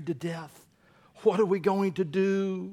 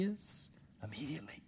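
whisper; 2 semitones lower, 14 dB quieter than usual. Acted. Whispered build-up.

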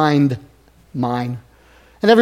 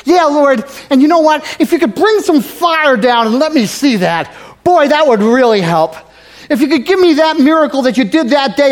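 higher, louder imitation of his younger eager self; mock eager self; 6 semitones higher, 6 dB louder than usual. Pastor imitating his old self begging God for a sign. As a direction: ham up his own pleading prayer for fire. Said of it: "This is what I first thought".

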